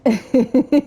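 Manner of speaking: quick run of giggles; laugh. Real, not acted. Player amused at having to scare Oswald, giggling.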